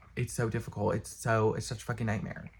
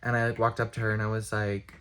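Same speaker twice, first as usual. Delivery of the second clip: usual speaking voice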